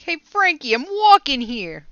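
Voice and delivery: loud, hammed-up Jersey mobster shout; over-the-top Jersey or New York street holler; Jersey mobster accent. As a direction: yell it high and big, like a Jersey wiseguy. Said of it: "just sounds like a mobster to me".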